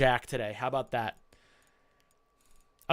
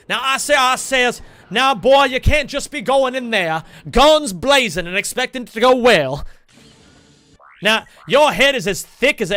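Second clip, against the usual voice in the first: Foghorn Leghorn impression; 5 semitones higher, 8 dB louder than usual. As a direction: boom it out like an old-timey Southern rooster lecturing a boy. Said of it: "he'll say".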